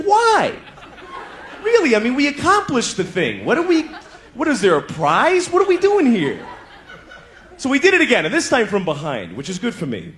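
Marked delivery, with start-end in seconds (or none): 0.0-6.4 exasperated whine. Retold or imitated. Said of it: "I was like".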